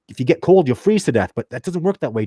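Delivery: normal talking voice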